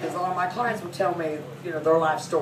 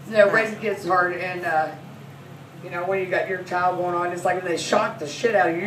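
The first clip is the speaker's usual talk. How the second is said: ordinary talk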